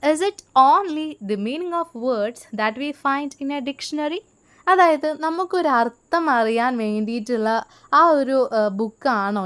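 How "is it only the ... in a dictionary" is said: slow, deliberate reading aloud to students; slow textbook reading. Shown as a demonstration. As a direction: read each word out slowly for the class. Reads English textbook line slowly. (പതുക്കെ പാഠപുസ്തകം വായിക്കുന്നു)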